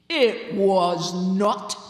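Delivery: slow, loud, weighty goblin reply; a goblin flatly contradicting him, slow and heavy; deep voice. Acted. Goblin on a tombstone contradicting the sexton, slow and loud. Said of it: "said a deep voice".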